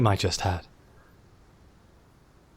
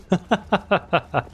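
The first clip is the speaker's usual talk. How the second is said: high bright laugh; giddy high laugh; delighted laugh; 8 semitones higher than usual. Acted. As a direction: burst out laughing. Laughing at the vision.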